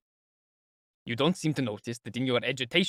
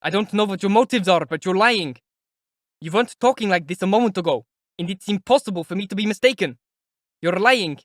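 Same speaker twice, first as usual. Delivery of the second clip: keeps shouting, higher and louder; loud angry insistence; 6 semitones higher, 6 dB louder than usual. Acted. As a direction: hammer him with the accusation. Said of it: "he shouted".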